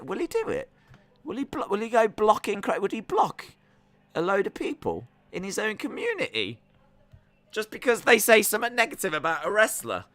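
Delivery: high-pitched, hammed-up questioning voice; mock incredulous questioner. Streamer voicing the chat's doubts about blocking, mock incredulous. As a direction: wonder aloud in a put-on high voice.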